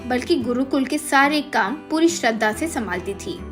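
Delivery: ordinary delivery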